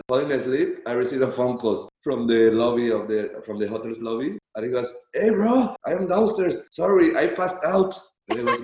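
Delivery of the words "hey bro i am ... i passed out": high-pitched mimicry of an apologetic friend; Mandragora impression. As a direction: imitate a pal calling with excuses, voice raised. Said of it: "You mimic him really well".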